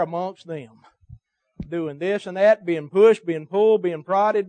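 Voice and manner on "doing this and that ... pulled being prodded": rising preacher shout. Real, not acted. Preacher building a list.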